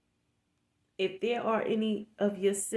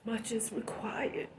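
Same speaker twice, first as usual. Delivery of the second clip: hushed whisper, no voice; 7 dB quieter than usual. Real, not acted. Vlogger repeating a maxim to viewers in a whisper. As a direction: breathe it out as a solemn reminder.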